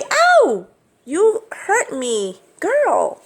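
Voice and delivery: high pained cry, slow scold; yelp, then chide the bird; real yelp then scolding. Real, not acted. Bitten by parrot.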